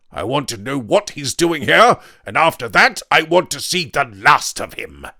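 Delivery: loud, hard refusal; angry Weston. A narrator's reading. Weston refuses to welcome the intruder, loud and angry. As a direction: bark at the intruder. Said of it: "said Weston".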